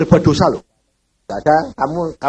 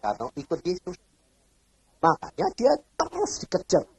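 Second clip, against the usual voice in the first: ordinary talk, nothing marked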